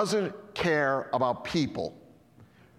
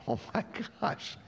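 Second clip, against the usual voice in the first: whispered amazement; 7 dB quieter than usual. Real, not acted. Amazed reaction to Jesus' command.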